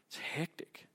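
breathy unvoiced hush; grim whispered aside to the congregation after the massacre. Real, not acted. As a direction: drop to a whisper as if to yourself. Aside after describing Herod's slaughter.

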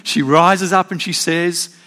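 ordinary talk, nothing marked

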